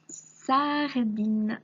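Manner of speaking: "sardine" slow, sar-dine, each syllable held; word called out. Shown as a demonstration. Teacher calling a word to find, slow syllables. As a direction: stretch "sardine" for the listener. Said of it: "nous allons chercher sardine".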